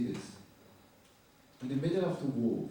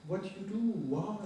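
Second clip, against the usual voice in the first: higher, disbelieving questions; shocked; 8 semitones higher than usual. Retold or imitated. Photographer asking the girl why, in shock. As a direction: voice the shock of the moment. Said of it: "And I just got shocked".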